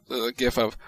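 ordinary talk, nothing marked

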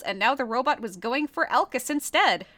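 ordinary talk, nothing marked

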